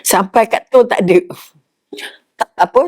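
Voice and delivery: usual speaking voice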